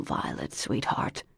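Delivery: soft whispered father; whispering. A narrator's reading. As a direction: whisper gently to the child. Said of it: "the dad said".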